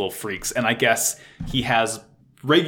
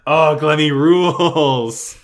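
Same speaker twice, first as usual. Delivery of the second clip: louder, slow gushing adoration; gushing praise; 2 semitones higher, 6 dB louder, slower than usual. Retold or imitated. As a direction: gush over him like a proud fan. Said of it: "I am like".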